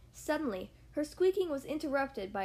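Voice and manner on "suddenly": ordinary delivery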